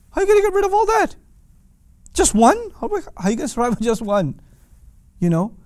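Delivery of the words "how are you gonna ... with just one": high, fast, scoffing disbelief; acting out outraged pagans who can't believe it; incredulous mockery. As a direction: scoff at the idea of one god. Mocking the disbelievers' objection.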